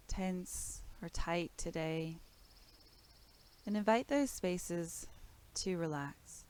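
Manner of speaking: calm, measured body-scan voice with long pauses; guided meditation. Real, not acted. Teacher guides listeners through breath and body awareness, calm meditation voice.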